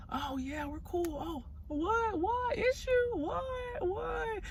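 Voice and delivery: very high, soft, fake-innocent squeak; mock playing dumb. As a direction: play dumb in a tiny high voice.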